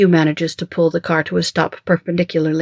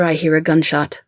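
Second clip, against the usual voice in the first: ordinary delivery, nothing marked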